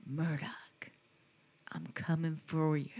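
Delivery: low, hushed, menacing hero growl; Rambo. Retold or imitated. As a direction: threaten quietly into the mic, imitating an action hero. Plays Rambo threatening Murdoch.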